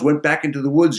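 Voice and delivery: ordinary delivery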